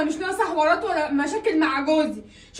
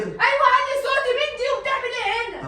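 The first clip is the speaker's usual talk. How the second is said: defiant shout; 6 semitones higher than usual. Acted. Wife refuses to calm down.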